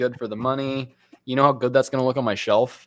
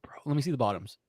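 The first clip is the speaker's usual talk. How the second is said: whispering; 8 dB quieter, faster than usual. Real, not acted. Whispering off to the side.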